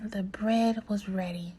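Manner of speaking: ordinary delivery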